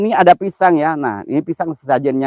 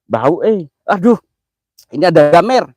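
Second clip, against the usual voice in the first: ordinary talk, nothing marked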